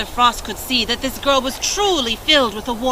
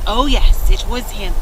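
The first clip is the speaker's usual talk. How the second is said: usual speaking voice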